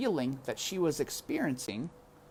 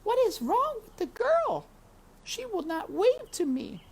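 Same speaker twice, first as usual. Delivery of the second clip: high little princess voice; high-pitched royal girl calling her papa; 3 dB louder than usual. Acted. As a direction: call up sweetly as a small princess. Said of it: "called the princess to the king".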